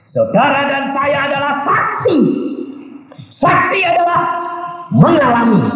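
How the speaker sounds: high, ringing sermon delivery; full-force preaching. Real, not acted. Preacher warns congregation about masks, proclaiming forcefully.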